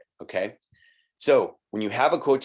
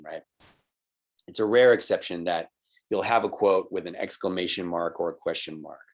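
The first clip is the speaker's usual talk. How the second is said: ordinary talk, nothing marked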